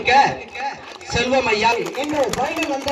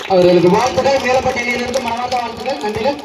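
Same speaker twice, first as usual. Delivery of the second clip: announcer; 5 dB louder than usual. Acted. Emcee congratulating winning team.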